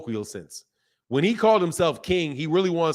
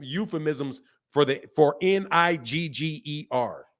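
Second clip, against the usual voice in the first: usual speaking voice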